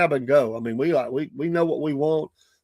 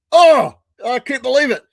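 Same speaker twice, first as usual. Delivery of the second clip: exasperated outcry; 9 semitones higher, 9 dB louder than usual. Retold or imitated. Exasperated at broken order box.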